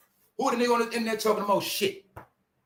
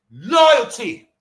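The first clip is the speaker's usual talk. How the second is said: emphatic; 8 dB louder than usual. Real, not acted. Hammering home his point.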